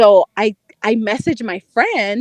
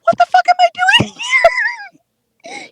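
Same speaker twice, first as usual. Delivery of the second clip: very high, rushed comic squeal; retold incredulous panic; much faster than usual. Telling friends what she thought in his room, incredulous. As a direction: replay your panic, shooting way up in pitch.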